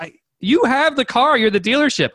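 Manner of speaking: high-pitched exasperated protest; freaking out. Retold or imitated. Buyer argues with dealership manager, freaking out. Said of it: "I'm saying, I'm freaking out".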